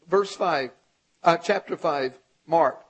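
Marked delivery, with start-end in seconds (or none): none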